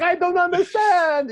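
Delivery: very high, loud, stretched-out mock wail; hammed-up helpless foreigner in a panic; freaking-out Italian man. Italian man baffled by the rental people, mock freak-out. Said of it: "He's freaking out".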